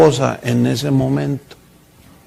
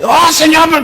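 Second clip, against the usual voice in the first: whiny protest; 11 semitones higher than usual. Retold or imitated. Pleading complaint to the Lord.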